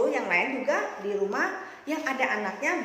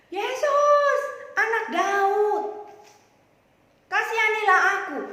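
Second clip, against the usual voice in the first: high, drawn-out pleading shout; loud crying out; 9 semitones higher, 8 dB louder, slower than usual. Acted. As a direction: call out to someone far off, stretching the words. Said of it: "Lalu dia berteriak loh, anak-anak, kencang loh". (berteriak kencang memohon)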